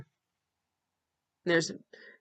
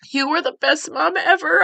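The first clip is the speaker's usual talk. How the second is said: real tears, higher and louder, voice breaking; crying while reading; 7 semitones higher, 9 dB louder than usual. Real, not acted. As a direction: let the tears push the words up.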